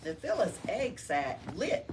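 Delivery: plain reading voice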